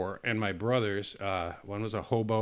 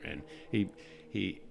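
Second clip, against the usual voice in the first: ordinary talk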